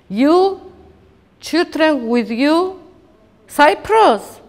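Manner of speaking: high, loud, slow accusation in broken English; accusing shout. Retold or imitated. Soldier accusing the mother at the border, shouting. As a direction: hurl big liar at her.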